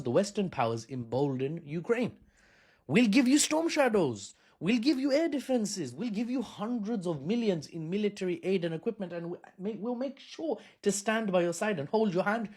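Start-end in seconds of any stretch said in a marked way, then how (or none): 2.9-12.5 eager promising voice. Retold or imitated. Voicing Western powers' promises.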